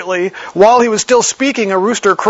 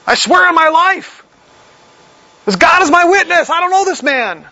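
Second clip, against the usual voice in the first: much higher, vehement oath of denial; swearing an oath; 10 semitones higher than usual. Acted. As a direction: swear it desperately on your life. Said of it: "he starts to cuss and to swear".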